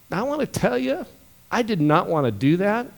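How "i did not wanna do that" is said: ordinary talk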